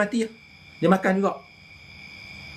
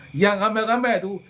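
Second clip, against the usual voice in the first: usual speaking voice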